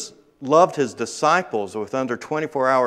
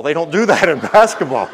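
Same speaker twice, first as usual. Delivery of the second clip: laughing; 4 semitones higher, 8 dB louder than usual. Retold or imitated. Adam laughing mid-line.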